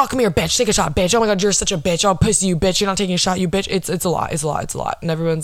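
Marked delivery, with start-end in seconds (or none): 0.0-3.7 imitating an aggressive drunk party girl; mock aggressive. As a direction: bark the bitch at them, half-hearted. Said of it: "the way she says bitch is just so aggressive".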